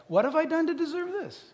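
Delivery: high, soft put-on protest; mock-hurt reply. Friend texting back in dismay, high mock-hurt voice. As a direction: voice it high, softly aggrieved.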